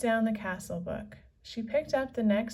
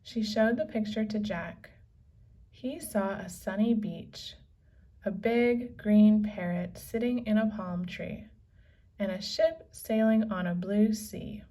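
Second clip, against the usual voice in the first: ordinary delivery, nothing marked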